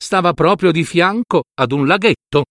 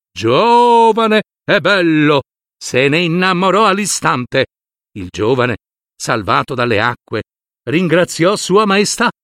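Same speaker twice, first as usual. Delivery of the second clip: ordinary delivery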